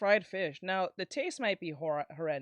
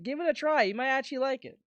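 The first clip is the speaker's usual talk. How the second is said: ordinary talk, nothing marked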